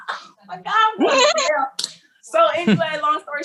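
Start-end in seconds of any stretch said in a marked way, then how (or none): 0.1-0.6 breathless whisper of dread; a hushed oh my God. Retold or imitated. Realising jail is coming. 0.7-1.9 much higher, slow, hammed-up panic; high, drawn-out mock dismay. Panic at going to jail.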